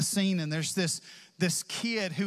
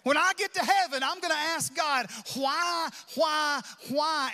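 imitated pleading why; 9 semitones higher than usual. Congregant voiced, pressing God.

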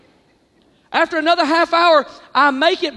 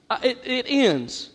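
normal talking voice

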